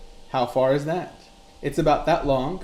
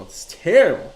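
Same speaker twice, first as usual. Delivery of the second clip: louder, higher emphatic "It's terrible"; emphatic repeat; 4 semitones higher, 7 dB louder than usual. Real, not acted. Repeating his dismay to Mike, louder and higher. Repeats lament with more force.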